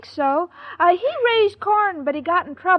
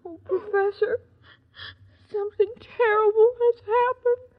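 crying; 3 semitones higher than usual. Acted. Irma greets the Professor in joyful tears, weepy. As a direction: sob out the news. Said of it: "You're crying".

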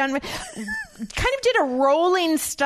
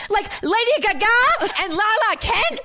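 higher, slowish New Jersey brag; Jersey accent; 8 semitones higher than usual. Pretend. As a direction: channel a reality-star Jersey accent. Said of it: "I love it when you do the Jersey accent".

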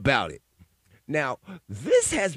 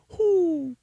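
high, louder owl "Who?"; owl hoot; 4 dB louder than usual. Retold or imitated. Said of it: "how an owl goes".

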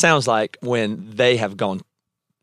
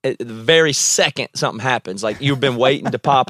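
normal talking voice

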